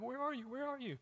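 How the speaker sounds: put-on high, soft small-child plea; imitating crying child. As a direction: echo a frightened toddler calling out softly. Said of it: "crying, like".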